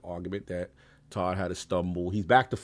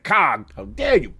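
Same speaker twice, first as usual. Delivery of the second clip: high, slow indignant mock outrage; 9 semitones higher, 6 dB louder, slower than usual. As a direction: play up fake offense. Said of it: "He said".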